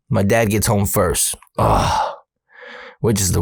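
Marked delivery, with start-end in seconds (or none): 1.6-2.1 low, throaty ugh; groan of dread. Retold or imitated. Teller dreads facing dad alone, low groan. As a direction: let out a deep dismayed groan.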